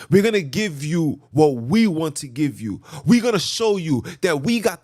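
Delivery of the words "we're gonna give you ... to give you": normal talking voice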